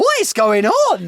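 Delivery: high, loud, drawn-out mock outrage; loud disbelief. Retelling shock at bitching till staff, loud disbelief. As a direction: exclaim it in exaggerated bafflement.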